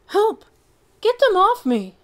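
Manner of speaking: very high, loud panic; dog crying out under attack; panicked cry. Acted. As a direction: scream for help against bees. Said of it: "Chunk cried".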